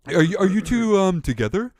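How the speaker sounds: jittery stuffed hippo; nervous question. Acted. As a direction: ask anxiously, afraid of the answer. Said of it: "He is terrified".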